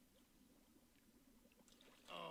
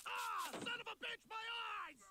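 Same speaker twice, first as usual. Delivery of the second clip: much higher, louder yelp of pain; pained scream; 6 dB louder than usual. Acted. Man sprayed in the eyes screams in pain. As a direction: shriek like your eyes are burning.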